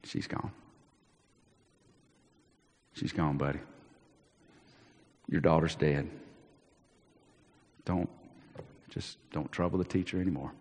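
soft, low, hushed delivery; gentle bad news. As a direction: break it to the father gently. Messenger tells father of death.

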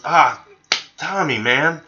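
high, slow, whiny protest about the cans; mock outrage. Retelling how Jay accused Tommy, high and whiny. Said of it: "rounds the corner and he goes".

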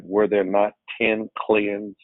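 usual speaking voice

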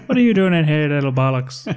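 loud, high Cockney bark; Cockney accent. Retold or imitated. Imagining the actor barking at his roommate, in a loud Cockney accent. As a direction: shout it like a rough Cockney geezer. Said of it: "He can do a Cockney accent".

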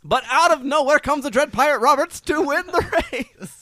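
high, loud race finish call; race caller. Acted. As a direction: bring the winner home.

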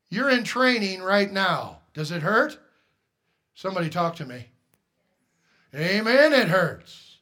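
fiery preaching; preaching at full force. Acted. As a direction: press the congregation hard. Preaching to congregation.

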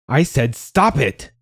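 high, slow, emphatic order; angry command. Acted. Mother at the end of patience with crying son, angry. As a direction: draw out the furious order.

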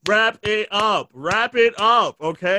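slow, high-pitched hurry-up call; mock impatience. Driver to mourners at a crash, mock-impatient call. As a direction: draw it out like a pestering driver.

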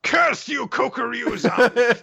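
very high, strained villain curse; hammed-up foiled villain shrieking in pain; sputtering foiled villain. Voicing the father's foiled curse.

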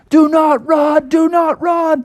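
slow, very high shouted order; emphatic cartoon command; frantic park ranger. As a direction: holler each word in a high voice. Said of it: "the park ranger was like".

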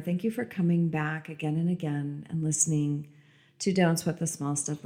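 usual speaking voice

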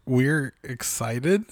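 EXCITED slowly rising like a question; questioning excitement. Real, not acted. Co-host unsure about celebrating birthdays, questioning "excited" with a rise. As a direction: make "excited" a doubtful, drawn-out question. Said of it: "Question mark?".

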